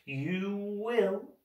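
high, drawn-out villainous order; stretched, ominous command; menacing insistence. Acted. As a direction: insist slowly, with quiet threat. Villain insists, staring into her eyes.

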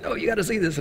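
eager plea. Retold or imitated. Urging people to look.

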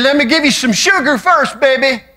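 put-on high, loud doting old lady voice; great-grandmother. Preacher imitating his great-grandmother greeting him, high doting voice. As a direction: coo like a toothless great-grandma. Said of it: "she said".

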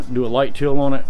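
normal talking voice

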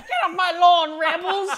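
put-on high, loud cranky old man yelling; grumpy old man. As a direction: shake your fist and shout at the kids. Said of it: "shaking his fist like an old man".

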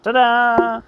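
high, loud fanfare; mock-grand flourish, pitched high; triumphant reveal. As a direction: sing out a showy reveal.

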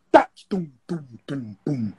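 beatbox each drum hit; imitating a drum kit by voice; vocal drum imitation. Shown as a demonstration. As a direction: mouth drums for a kit check. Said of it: "Eu vou fazer pra vocês".

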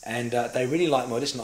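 ordinary talk

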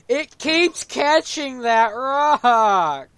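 slow, high, loud wail of frustration; exasperated yell. Real, not acted. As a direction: drag out every word, yelling. Player rages at snag in game.

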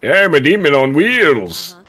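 boastful demon. Acted. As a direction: ham it up like a speed demon. Boasting about fast arrival.

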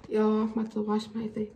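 ordinary talk, nothing marked

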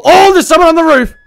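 high, loud warning; officer spotting a gunman on the roof; alarmed callout. Pretend. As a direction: shout it to the team.

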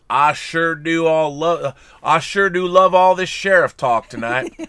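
stretched-out mock sheriff drawl; Western sheriff. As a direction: read it as a folksy lawman. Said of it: "Sheriff Carl sends in a tip".

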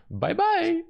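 very high cartoon voice; Luigi. Player reads the board's farewell as Luigi. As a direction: squeal it in a high character voice. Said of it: "must be read in Luigi's voice".

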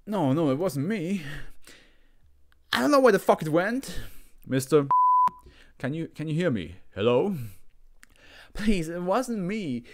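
high desperate pleading. Acted. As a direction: plead your innocence frantically. Subject denying desperately.

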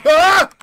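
brief, piercing, loud shriek; startled scream. Real, not acted. Player hearing giggling, startled scream. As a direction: scream in sudden fright. Jump scare.

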